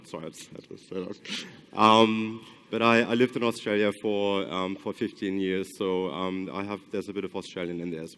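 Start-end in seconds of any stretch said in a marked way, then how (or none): 0.1-1.8 sheepish aside. Real, not acted. Sheepish aside after a joke.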